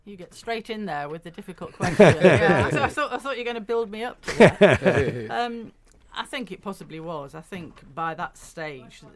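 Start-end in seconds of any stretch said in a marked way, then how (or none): 0.0-2.2 loud, laughing protest; teasing laugh. Real, not acted. Guest teasing the host about a tough opening question, laughing. 2.2-5.3 higher, much louder, through laughter; mock complaint with a big laugh; teasing laugh. Real, not acted. Guest joking to the host about the blunt opener, laughing=.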